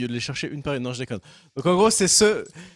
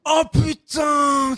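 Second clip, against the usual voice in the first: slow, high, drawn-out amazed shout; stunned shout; 8 semitones higher, much slower than usual. Real, not acted. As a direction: stretch it out, floored. Amazed at one-handed push-ups.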